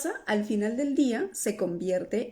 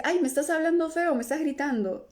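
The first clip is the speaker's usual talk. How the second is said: higher, mildly whiny protest; hurt complaint; 6 semitones higher than usual. Retold or imitated. Someone telling you you're shouting at them, mildly hurt whine. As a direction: complain lightly that you're being yelled at. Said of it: "alguien te puede decir".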